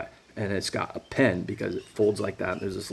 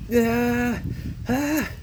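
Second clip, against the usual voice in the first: frustrated cry; 4 dB louder than usual. Real, not acted. His frustrated exclamation.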